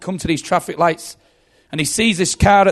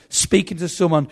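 usual speaking voice

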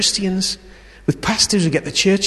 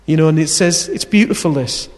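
ordinary talk, nothing marked

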